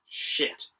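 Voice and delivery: hushed whispered "Shit" while hiding; whispered curse. Acted. Bond hiding behind safe.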